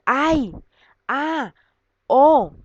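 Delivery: high, loud one-word exclamations; demonstrated cries of pain or surprise; exclamation intonation. Shown as a demonstration. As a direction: cry out each interjection separately. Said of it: "De esa manera se leen y se hace la entonación".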